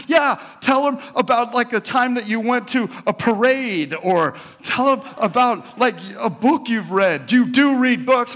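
high, loud, nagging woman's voice; mimicked pushy woman. Telling the nephew to talk up his life, high and nagging.

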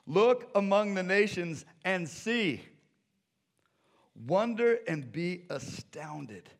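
loud, high proclaiming; preached scripture. Acted. Preacher reading God's answer to the congregation, proclaiming.

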